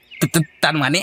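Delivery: stammering toady. Acted.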